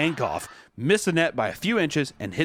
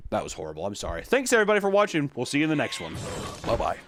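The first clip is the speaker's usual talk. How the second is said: normal talking voice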